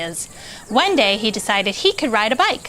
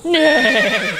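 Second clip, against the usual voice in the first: a short neigh, a bit louder; horse neigh; 4 semitones higher, 3 dB louder than usual. A narrator's reading. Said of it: "said Horse".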